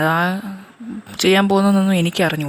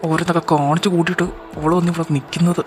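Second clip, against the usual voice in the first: furious. Acted. Husband angrily accusing his wife over the burned child. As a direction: tear into her.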